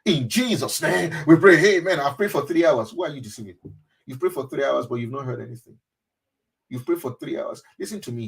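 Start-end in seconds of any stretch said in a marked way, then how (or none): 0.0-2.9 mock rushed prayer. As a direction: parody a showy churchgoer finishing a prayer. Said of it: "rush out of his presence".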